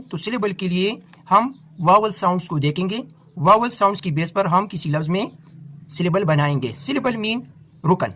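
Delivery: ordinary delivery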